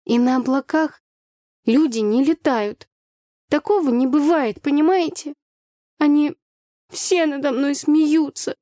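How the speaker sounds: put-on high, slow child's protest; upset little girl. A narrator's reading. Child insists to teacher people can't fly, high upset voice. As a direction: protest like a hurt small child. Angry child continues her answer.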